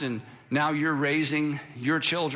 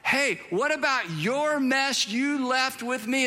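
higher, slow indignant call; higher, drawn-out protest after someone leaving; mock complaint; 6 semitones higher, slower than usual. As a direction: call after them, slowly and pointedly. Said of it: "you're like".

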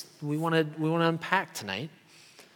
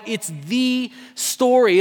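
the stressed, slow and drawn out; 3 semitones higher, 4 dB louder, slower than usual. Shown as a demonstration. Preacher to congregation, slow stress on the. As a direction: lean hard on the, as in the one and only. Contrasting a story with the story.

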